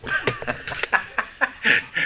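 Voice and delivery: a quiet laugh after the punchline; soft laugh. Real, not acted. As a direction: chuckle softly at your own joke.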